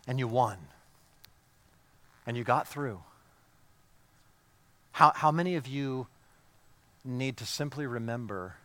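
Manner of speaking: drops to a soft, intimate hush; hushed preaching. Acted. Preacher softly reassuring a struggling congregation, hushed. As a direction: lean in close and speak the comfort gently.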